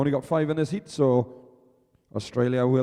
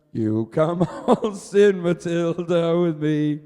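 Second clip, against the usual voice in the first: slow sung line through a laugh; slow, laughing sung invitation; laughing singalong; 4 semitones higher, 4 dB louder, slower than usual. Real, not acted. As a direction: sing and laugh at once. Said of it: "singing with a laugh".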